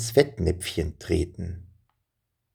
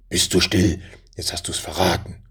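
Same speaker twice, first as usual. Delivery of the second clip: a hushed, sharp rebuke sliding into a whisper; scolding partner. A narrator's reading. Bandit hushes his partner who gave it away. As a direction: shush him, then whisper the reproach.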